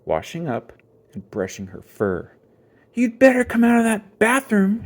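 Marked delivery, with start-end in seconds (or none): 2.9-4.8 loud, high threatening shout. A narrator's reading. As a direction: yell through the door. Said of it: "shouted brother".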